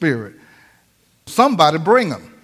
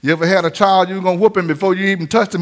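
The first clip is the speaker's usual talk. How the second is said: ordinary talk, nothing marked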